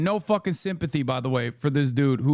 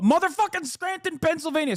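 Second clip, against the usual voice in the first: disbelieving yelp, much higher; incredulous; 13 semitones higher than usual. Real, not acted. Streamer learns the ambassador's hometown, voice jumping high in disbelief. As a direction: pitch shoots way up on Scranton. Surprised at the birthplace.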